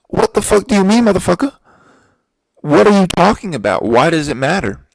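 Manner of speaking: high, loud mock rant at the teller; angry outburst. Retelling his rant at the bank teller, high, loud and furious.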